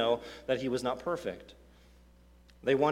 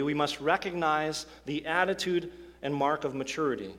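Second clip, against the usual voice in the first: normal talking voice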